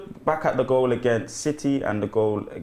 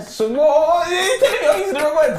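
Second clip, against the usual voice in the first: high, sing-song, laughing defence; laughing protest; 6 dB louder than usual. Real, not acted. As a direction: protest through giggles. Defending himself while laughing.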